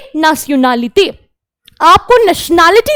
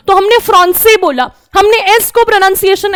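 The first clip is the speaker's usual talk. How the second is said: ordinary talk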